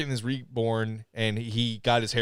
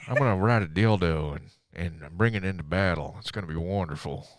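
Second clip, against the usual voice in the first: lightly acted low-pitched hero voice; Johnny Blaze; 6 semitones lower than usual. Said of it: "I'm Johnny Blaze".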